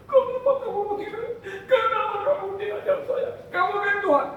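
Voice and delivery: high, fast, hammed-up indignant voice; a light mock of someone offended by Jesus; mock offended protest. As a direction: play the hurt complainer. Preacher voices offended listener.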